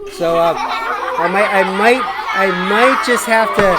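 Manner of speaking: laughing through the words; chuckling at her own joke. Real, not acted. Joke about buying pizza.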